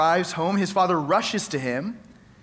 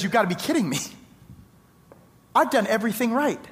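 mock indignation; 5 semitones higher than usual. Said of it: "start chewing his dad out".

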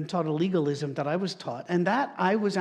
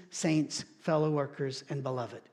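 ordinary talk, nothing marked

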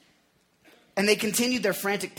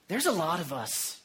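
ordinary talk, nothing marked

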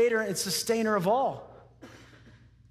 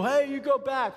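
higher, brusque call; mock stern parent; 5 semitones higher than usual. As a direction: send the child off sharply, hammed-up. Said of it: "and you don't go".

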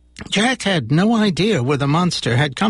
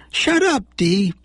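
put-on boy's fond tease; 4 semitones higher, slower than usual. Sean teasing fondly.

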